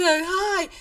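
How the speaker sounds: high, loud mock-friendly hi; over-friendly greeting. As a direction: fake recognition with a big bright hello. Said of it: "And I'm like".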